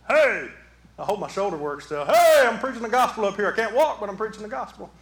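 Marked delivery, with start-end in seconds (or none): 0.1-0.5 calling out. Pretend. Acts hobbled preacher calling out. 2.0-4.9 loud, raised playful holler; hearty calling out. Pretend. Hobbled preacher calling out to church, playful holler.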